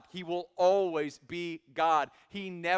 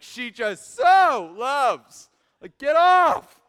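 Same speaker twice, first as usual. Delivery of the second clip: mock exasperated shout; 9 semitones higher, 15 dB louder, much slower than usual. Mock-exasperated about smothering mother.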